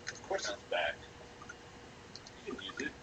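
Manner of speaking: whispered aside; whispering. Real, not acted. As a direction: whisper it under your breath. Quiet aside to friend.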